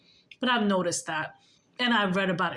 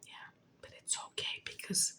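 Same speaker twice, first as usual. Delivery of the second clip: softer, hushed knowing whisper; whispered accusation; 14 dB quieter than usual. Real, not acted. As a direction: whisper it like a secret you caught. Hushed aside.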